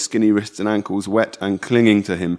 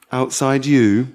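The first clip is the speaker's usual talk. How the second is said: plain reading voice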